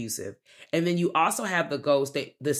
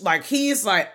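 ordinary delivery

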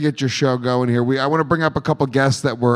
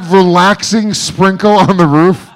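higher and louder dude voice, hammed-up; mock bro voice; 6 semitones higher, 7 dB louder than usual. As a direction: imitate a bro marvelling at the noise. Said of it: "Andrew's like".

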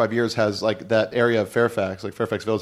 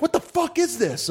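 high mock outrage; hammed-up indignant friend; pitched way up, comic affront; friend's outrage. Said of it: "he's like".

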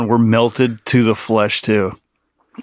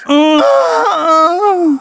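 high breathy goose honk; sexy goose voice. Pretend. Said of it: "please do a sexy goose voice".

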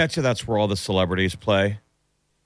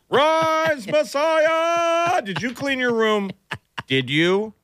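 very high shouted call; yelling across the room; much slower than usual. Pretend. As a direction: yell up the stairs at the kid. Parent yelling to kid.

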